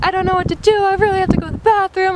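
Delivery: high, desperate mock pleading; retold freaking out. Traveler needing the bathroom begging janitors, desperate. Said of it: "I was just freaking out".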